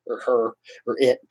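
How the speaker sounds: ordinary talk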